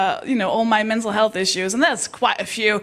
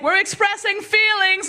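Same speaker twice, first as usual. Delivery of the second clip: high, exclaiming voice; gleeful fist; 8 semitones higher than usual. Acted. Fist declaring itself mid-punch, high and exclaiming. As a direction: announce it as a triumphant fist. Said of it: "my fist was like".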